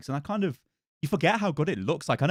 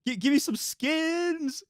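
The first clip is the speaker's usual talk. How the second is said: whiny begging voice; much slower than usual. Pretend. Joking plea to Jeff.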